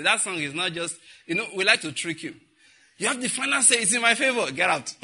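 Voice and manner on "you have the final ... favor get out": mock demand. Mocking presumptuous prayer.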